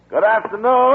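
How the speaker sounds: loud, high hail into the house; calling out. Acted. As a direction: call out to whoever's inside.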